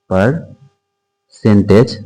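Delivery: "Percentage" very slow, broken after the first syllable; drawn-out dictation. Shown as a demonstration. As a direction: stretch "percentage" out in pieces, as if writing it. Teacher spells out topic slowly.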